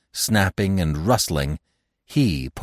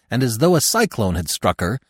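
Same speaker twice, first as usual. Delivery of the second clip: plain reading voice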